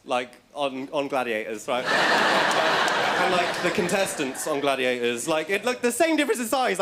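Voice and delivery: usual speaking voice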